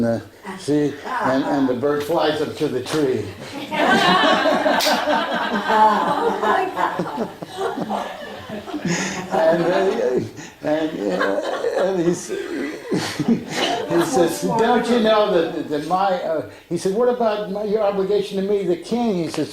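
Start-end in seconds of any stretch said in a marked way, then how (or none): 3.3-9.3 long high helpless laugh breaking out; burst of laughter. Real, not acted. Storyteller cracks up at punchline. 9.3-14.4 words broken by high giggles; laughing while talking. Real, not acted. Laughing through the story's punchline. 14.4-19.1 high-pitched, laughter running through the king's words; laughing quote. Real, not acted. Quotes king while still laughing.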